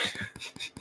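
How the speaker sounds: quiet, voiceless laugh. Real, not acted. Laughing off trolls in the live chat, quiet laugh. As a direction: laugh softly under your breath.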